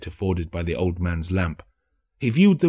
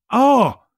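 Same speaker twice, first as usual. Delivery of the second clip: high, loud laughing cry; a sharp cackling ah; mock laugh; 8 dB louder than usual. A narrator's reading. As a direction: burst out laughing at the question. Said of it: "laughing and leaning forward".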